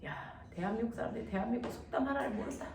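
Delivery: low-volume, disbelieving grumble; quiet dismay. Real, not acted. Teacher to students who know no Korean proverb, quietly appalled. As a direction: drop to a hushed, appalled aside.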